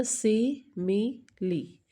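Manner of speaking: Simile slowly split as si, mi, li; syllable by syllable. Shown as a demonstration. Teacher counts syllables for students, simile broken into three parts. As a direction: count out simile in three separate slow beats. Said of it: "three syllabic word है".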